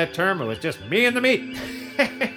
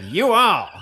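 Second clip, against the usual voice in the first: "You all" drawled, louder Southern; Kentucky colonel accent; 5 semitones higher, 5 dB louder than usual. Shown as a demonstration. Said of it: "sound like a genuine Kentucky colonel".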